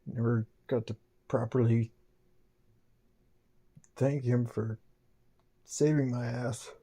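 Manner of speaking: soft, halting, subdued thanks; moved, grateful. Real, not acted. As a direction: say it quietly, still choked up. Moved, regrets never thanking friend.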